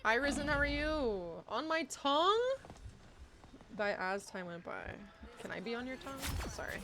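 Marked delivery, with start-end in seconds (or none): none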